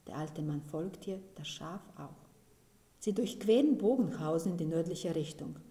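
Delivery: ordinary delivery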